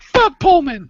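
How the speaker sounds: very high, loud knee-jerk yell; yelled outburst. Retold or imitated. Acting out hearing pole and man, yelling. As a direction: blurt it at the top of your voice. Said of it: "I'll just yell it out".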